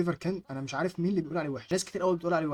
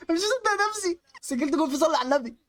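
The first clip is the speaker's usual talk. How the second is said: very high, excited squeal; disbelieving celebration; 11 semitones higher, 4 dB louder than usual. Real, not acted. Gamer who cannot believe he scored, disbelieving celebration. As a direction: squeal it, thrilled beyond belief.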